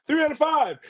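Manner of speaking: pleading demand. Retold or imitated. Co-host mimicking beaten fighter, high-pitched demand.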